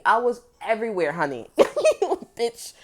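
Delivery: normal talking voice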